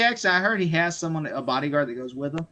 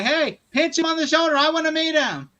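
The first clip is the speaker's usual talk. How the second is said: much higher pitched, imitated eagerness; high mock-eager voice; retold eager request; 10 semitones higher than usual. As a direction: call it out high to the bodyguard. Retold man calling out to bodyguard.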